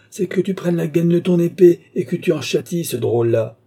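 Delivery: high, loud outburst; enraged baron at the top of his voice; furious. A narrator's reading. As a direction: cry out the order in a fury. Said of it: "s'écria le baron pâle de fureur".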